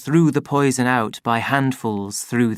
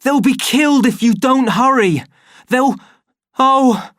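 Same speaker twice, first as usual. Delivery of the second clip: high, loud, cut off by a gasp; desperate yell broken off mid-word; panicked screaming; 12 semitones higher, 6 dB louder than usual. Acted. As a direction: scream until the gas knocks you out. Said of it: "screamed Bernard".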